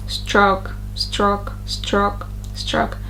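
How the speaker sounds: STROKE with a short single vowel, no glide. Shown as a demonstration. Teacher replaying their first mistake, short undiphthonged O in STROKE. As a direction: clip the O in STROKE without an oo ending. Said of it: "I said stroke differently".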